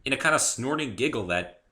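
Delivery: plain reading voice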